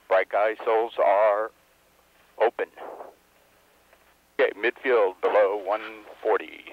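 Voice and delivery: usual speaking voice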